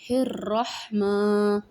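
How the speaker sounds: الرحمن stretched very slowly with a long ma; teacher repeating the word; slow recitation. Shown as a demonstration. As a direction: draw out the ما in الرحمن. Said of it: "تو کیسے پڑھیں گے؟".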